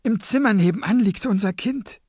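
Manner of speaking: high, pleading, stumbling voice; frightened young captive. Acted. Captive father swears to gunmen he's harmless, high pleading. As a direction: plead nervously in a high pitch.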